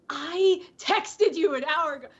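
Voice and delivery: a retold flash of temper, lightly played; mock angry snap. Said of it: "it was a momentary snap".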